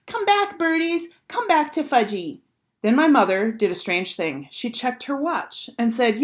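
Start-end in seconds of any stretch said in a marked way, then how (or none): 0.1-2.4 put-on high, little-kid shout at birds; toddler screaming. A narrator's reading. Fudge calling after pigeons, high toddler cry. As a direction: wail after the flying pigeons. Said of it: "was screaming".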